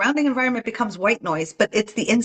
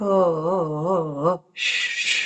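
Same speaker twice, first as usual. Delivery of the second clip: put-on lulling a baby; 2 semitones lower, slower than usual. Shown as a demonstration. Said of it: "like a baby".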